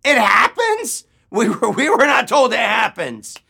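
high, loud indignant echo; incredulous outrage. Retold or imitated. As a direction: throw the words back in disbelief. Outraged echo of owner's casual reply.

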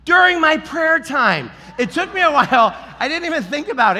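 high, loud outburst; exclaimed punchline. Real, not acted. Preacher confessing his anger's timing, loudly. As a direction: blurt out the irony at full volume.

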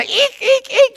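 high squeaky monkey eeks; monkey impression. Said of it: "that's my impression of a monkey".

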